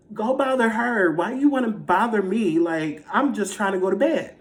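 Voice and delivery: high, loud, exasperated protest; indignant complaint. Retold or imitated. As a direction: plead your case to an unwanted ghost, hammed-up. Complains to the ghost.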